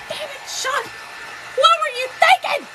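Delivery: high, laughing take on his scolding; mock-angry outburst through laughter; imitated cussing.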